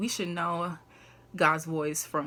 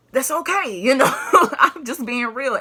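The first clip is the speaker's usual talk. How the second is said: laughing; 8 semitones higher, 12 dB louder than usual. Real, not acted. Laughing, high and loud.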